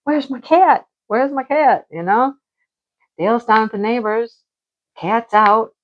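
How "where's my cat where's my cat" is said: anxious calling. Retold or imitated. Alone at the door in the storm, high worried cry. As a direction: fret aloud, pitch climbing, for the missing cat. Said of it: "I'm like".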